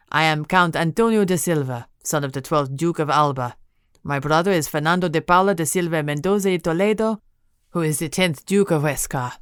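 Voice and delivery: put-on proud count with a thick Spanish accent; Spanish-accented count boasting his lineage. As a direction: roll out the titles in a Spanish accent. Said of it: "a deep, booming voice and a thick Spanish accent".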